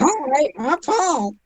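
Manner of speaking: high-pitched echo of an impression; Paul. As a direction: copy the character's greeting in a high put-on voice. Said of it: "It reminds me of the, that one thing".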